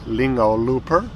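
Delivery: ordinary talk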